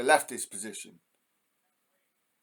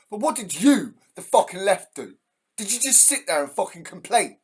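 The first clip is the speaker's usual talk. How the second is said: angry ranting; 6 semitones higher, 5 dB louder than usual. Real, not acted. Angry rant at the American left.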